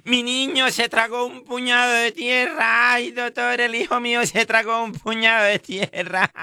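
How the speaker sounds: high, whiny worried mother; distressed mother. Retold or imitated. Mother telling the doctor her boy swallowed dirt, high whiny plea. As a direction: plead with the doctor in a put-on high voice.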